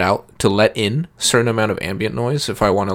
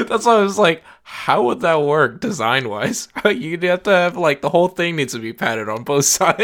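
laughing at own mix-up; giggling through the words; 7 semitones higher than usual. Real, not acted.

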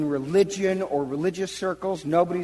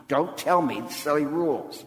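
ordinary talk

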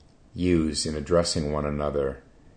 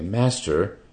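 louder and higher, an offended protest; indignant protest; 3 semitones higher, 6 dB louder than usual. Acted. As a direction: protest as if the question were an insult. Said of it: "the monks answered indignantly".

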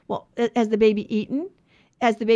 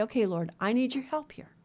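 much softer, a murmured plea to the Lord; quiet prayer; 7 dB quieter than usual. Pretend. Believer praying for help, much softer. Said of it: "and say".

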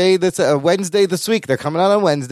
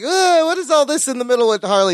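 higher-pitched groaning complaint; exasperated; 7 semitones higher than usual. Retold or imitated. Cohost recalling his reaction to Harley, exasperated. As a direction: act out an annoyed reaction, starting on a groan.

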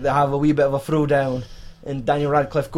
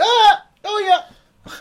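far higher, louder, slow hammed-up wail; mock pain cry; 6 dB louder, much slower than usual. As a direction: stretch out a comic cry of agony.

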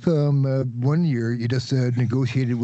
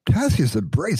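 high-pitched snooty official; high bureaucrat voice; 6 semitones higher than usual. Acted. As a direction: pitch up as a haughty clerk. Bureaucrat repeats the name.

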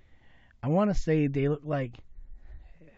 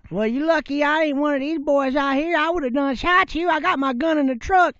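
put-on high, loud rant in a Southern accent; mimicked Southern yelling; 11 semitones higher, 5 dB louder than usual. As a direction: yell threats like an irate Southern local. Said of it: "he started yelling at us".

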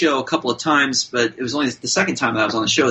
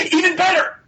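high-pitched excited outburst; imitated actor getting thrilled, pitch shooting up; Martin Sheen. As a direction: burst out with hammed-up delight. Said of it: "he got so excited".

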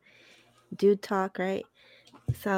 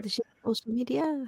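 put-on French accent; 4 semitones higher than usual. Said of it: "Pepe Le Pew over here".

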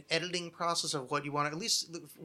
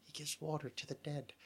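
Dune quote; 12 dB quieter than usual. Retold or imitated. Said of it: "making a fuckload of Dune references".

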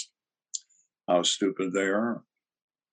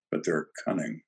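ordinary talk, nothing marked

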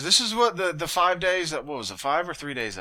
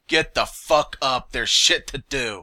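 slow, louder mock wake-up order; retold yell; 4 semitones higher, 7 dB louder, slower than usual. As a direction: bark it like a drill sergeant, slowly. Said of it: "busted your door down and said".

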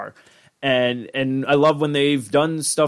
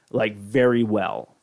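ordinary talk, nothing marked

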